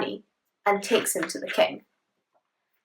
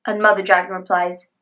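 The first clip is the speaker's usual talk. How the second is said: ordinary delivery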